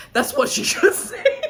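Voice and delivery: words breaking into a high laugh; laughing at own joke; laughing through words. Real, not acted. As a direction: crack up mid-sentence.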